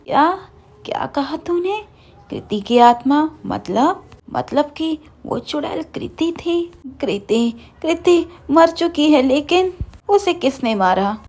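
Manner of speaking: horrified, can barely believe it; shocked disbelief. A narrator's reading. Aunt learns her niece is dead.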